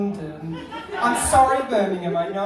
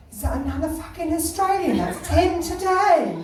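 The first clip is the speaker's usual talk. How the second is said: loud, higher, fed-up London shop worker; exasperated sales assistant; 7 semitones higher, 3 dB louder than usual. Retold or imitated. Sales assistant to colleague, exasperated mock complaint. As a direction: complain loudly, dragging out the last part. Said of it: "turned to their colleague and said".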